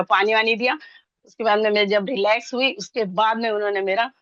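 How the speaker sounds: usual speaking voice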